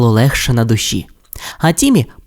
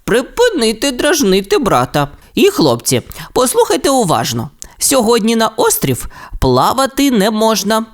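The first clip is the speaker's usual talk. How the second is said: суворувата мама; 8 semitones higher than usual. Acted. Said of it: "склала руки на грудях і сказала".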